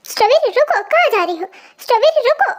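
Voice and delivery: high-pitched call to stop someone leaving; calling after someone. Acted. Boy calls after his sweetheart as she leaves, pleading call. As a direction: call out, voice lifting on each plea.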